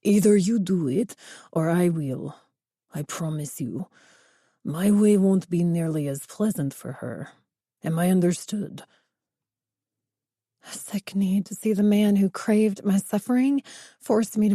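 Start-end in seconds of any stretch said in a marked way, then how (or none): 0.0-8.8 put-on a threatening boss in thick Spanish accent. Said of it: "A heavy Spanish accent".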